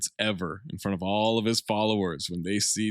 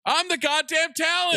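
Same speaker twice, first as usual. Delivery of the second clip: pitched-up, indignant mock star voice; mock diva; 3 dB louder than usual. Host mocks himself as a vain star, high indignant voice. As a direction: whine like a slighted star.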